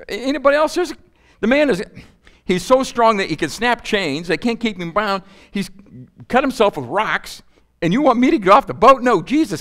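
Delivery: high-pitched, protesting disciple in a mock panic; alarmed disciple. Disciple pleading with Jesus to stay in the boat, mock alarm. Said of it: "what I'm thinking if I'm a disciple".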